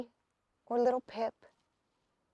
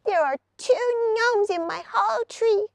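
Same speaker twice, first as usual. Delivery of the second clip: much higher, unhappy lizard; miserable lizard; 9 semitones higher than usual. A narrator's reading. Lizard tells Hazel about the gnomes, much higher. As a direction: moan it like a tiny creature. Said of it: "the lizard said miserably".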